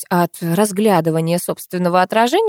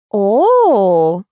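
louder, drawn-out impressed oh; 2 semitones higher, 6 dB louder than usual. Acted. Girls see the photo, drawn-out impressed oh. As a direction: let the oh stretch in awe. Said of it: "дружно протянули они".

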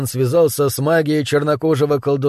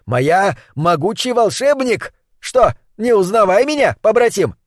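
higher, boastful broken-speech sorcerer; 6 semitones higher than usual. Acted. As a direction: boast like a proud old witch doctor. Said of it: "хвастливой манере".

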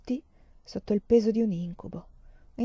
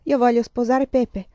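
firm and louder, cutting it short; curt, decisive; 4 semitones higher, 7 dB louder than usual. A narrator's reading. Pilar announcing to the family she'll marry Pepe, decisive and louder. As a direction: state it flatly, no room for argument. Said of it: "disse con voce recisa".

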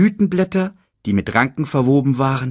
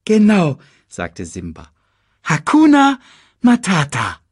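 cheerful; 6 semitones higher, 6 dB louder than usual. Acted. Simba echoes motto.

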